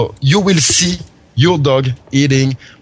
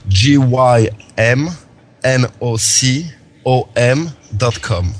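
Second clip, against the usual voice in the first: spelling it out; much slower than usual. Real, not acted. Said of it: "can you spell out the website".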